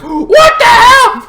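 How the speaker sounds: high, loud, drawn-out yell; startled shout. Acted. Driver reacting to honking truck behind, startled shout. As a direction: shriek it in outraged alarm.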